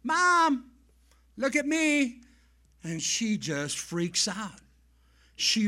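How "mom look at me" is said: put-on high, louder little boy's call. Boy on the swing set to his mom, calling out. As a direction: call out proudly to get attention. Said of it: "he calls out".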